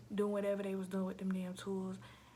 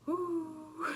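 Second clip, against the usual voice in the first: woo exhale; 8 semitones higher, 5 dB louder than usual. Real, not acted. Exhale after recalling recovery.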